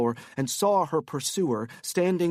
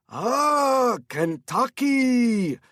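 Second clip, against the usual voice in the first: much higher and louder, slow delighted exclamation; delighted cry; 10 semitones higher, 6 dB louder, much slower than usual. Acted. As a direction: cry it out, thrilled by the name. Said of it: "he cried".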